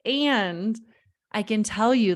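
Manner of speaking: ordinary delivery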